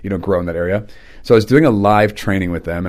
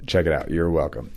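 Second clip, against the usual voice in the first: normal talking voice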